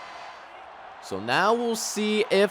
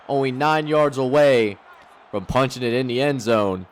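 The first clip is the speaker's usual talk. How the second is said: usual speaking voice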